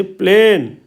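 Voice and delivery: "प्लेन" with the ए held long; teacher modelling the long ए in "प्लेन"; lengthened ए. Shown as a demonstration. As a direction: stretch the vowel of "प्लेन" and let it fall. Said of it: "लंबा है इसका उच्चारण".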